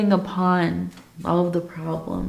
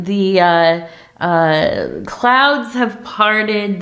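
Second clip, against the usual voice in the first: ordinary talk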